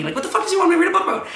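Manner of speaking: high, very fast rant; re-enacted heated protest; exasperated outburst. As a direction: rattle it off in high-pitched disbelief. Retelling the shouting match, exasperated.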